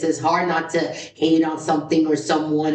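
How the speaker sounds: ordinary delivery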